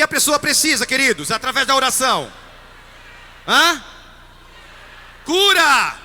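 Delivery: high, loud fervent sermon voice; full-force preaching. Acted. Priest rouses his congregation against fear, preaching loudly. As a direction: preach it out to the whole church.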